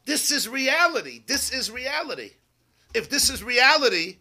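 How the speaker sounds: loud, high-pitched declaration; emphatic insistence. Acted. Acts out the mind's insistent conviction.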